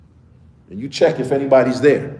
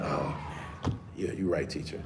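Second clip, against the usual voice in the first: put-on sheepish kid; 10 semitones lower, 16 dB quieter than usual. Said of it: "and they be like".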